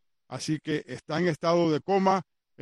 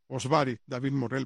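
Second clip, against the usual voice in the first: normal talking voice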